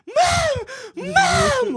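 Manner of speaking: very high panicked shriek for Mom; imitated freaking out. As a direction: scream for your mother like a scared kid. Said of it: "like, freaking out".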